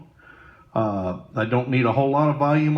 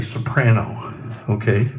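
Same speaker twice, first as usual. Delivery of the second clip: usual speaking voice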